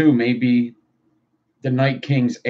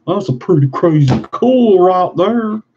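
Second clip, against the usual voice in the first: high, loud silly exclamation; loud mock-excited voice; hammed-up excitement; 6 semitones higher, 7 dB louder than usual. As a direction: repeat it higher and louder, playing it up. Remark repeated in put-on voice.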